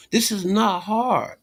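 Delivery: high, sing-song taunting tone; mocking exasperation. Real, not acted.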